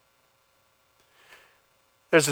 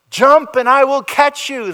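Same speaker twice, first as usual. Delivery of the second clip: high, loud call through the smoke; father calling out; 13 semitones higher, 8 dB louder than usual. Retold or imitated. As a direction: yell up to the boy at the window. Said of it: "the father says".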